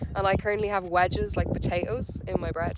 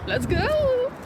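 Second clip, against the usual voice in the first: excited. Real, not acted. Excited start of the cold swim.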